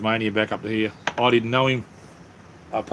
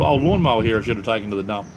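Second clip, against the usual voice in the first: ordinary talk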